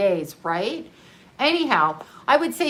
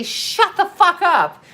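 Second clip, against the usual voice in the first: loud, high, drawn-out mock yell; exasperated outburst; 8 semitones higher, 4 dB louder, slower than usual. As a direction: stretch it out loudly to silence someone. Acts out silencing an Aries.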